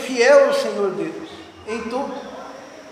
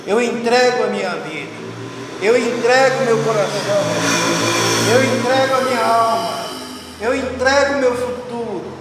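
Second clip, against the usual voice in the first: prayer; 2 semitones higher than usual. Acted. Pastor praying aloud over the altar, fervent and rising. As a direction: pour it out as an offering, rising with each line.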